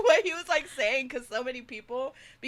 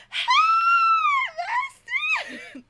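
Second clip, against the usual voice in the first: loud greeting; 11 dB louder, much faster than usual. Retold or imitated. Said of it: "my loud ass".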